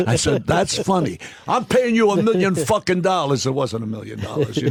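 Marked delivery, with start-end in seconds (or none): 0.5-3.4 high-pitched mock indignation; retold indignant outburst. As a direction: pitch jumps up, acting out an angry retort. Retold quarrel over the movie stunt.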